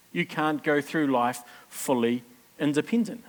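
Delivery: ordinary talk, nothing marked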